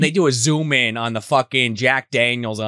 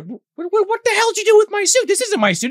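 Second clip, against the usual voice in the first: high, louder, fast frantic voice; mock panic; 4 dB louder than usual. Candidate yelling at Peter about the suit, high and frantic. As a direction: squeal it out in a rush.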